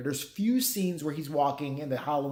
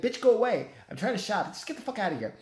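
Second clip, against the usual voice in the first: higher, exasperated dismissal; mock irritated brush-off; 6 semitones higher, faster than usual. Shopper tells candle salesgirl to leave, mock exasperation. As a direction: act fed up with a pestering clerk.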